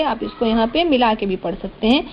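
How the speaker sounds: ordinary talk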